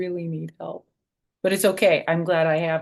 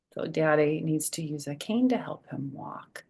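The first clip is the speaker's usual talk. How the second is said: usual speaking voice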